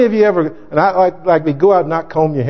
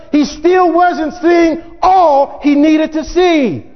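preaching at full force; 10 semitones higher than usual. Acted. Preacher to his congregation, shouting the point home. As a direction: drive it home to the congregation, stretching the words.

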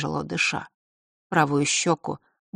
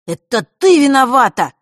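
high, loud angry shout; 8 semitones higher, 11 dB louder than usual. Acted. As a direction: blame her, shouting. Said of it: "рассердился вдруг Фэш".

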